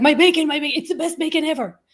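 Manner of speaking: over-eager vendor showing off; bragging seller. Acted. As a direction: gush proudly about your own product. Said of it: "And you're like".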